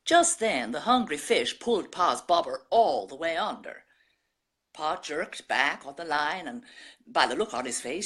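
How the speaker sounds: folksy American country storyteller, put on by a Brit; American accent. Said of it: "It's a debut in American".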